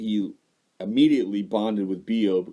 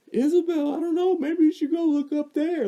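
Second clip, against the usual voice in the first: high, sugary coaxing grown-up; sweet coaxing parent. Retold or imitated. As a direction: coax a little girl sweetly. Voicing parents urging sister.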